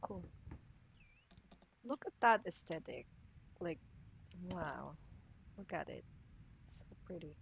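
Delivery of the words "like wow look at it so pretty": unvoiced soft admiration; hushed admiring; awed whisper. Real, not acted. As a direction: breathe it in wonder. Admiring scenery.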